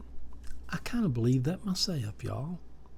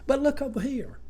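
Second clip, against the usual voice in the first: normal talking voice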